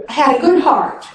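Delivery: ordinary delivery, nothing marked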